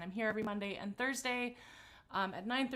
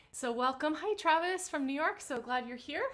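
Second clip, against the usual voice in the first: high, loud cheerful hello; excited greeting; 6 semitones higher, 5 dB louder than usual. Real, not acted. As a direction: call out a happy hello. Greeting viewer in chat.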